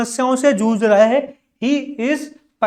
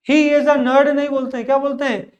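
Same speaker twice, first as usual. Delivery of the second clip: ordinary talk, nothing marked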